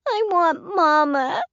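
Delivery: put-on high, slow, wailing little-girl voice; sobbing child. A narrator's reading. Addie sobbing outside the shop, wailing child voice. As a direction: cry for Mama again, heartbroken. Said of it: "Addie sobbed again".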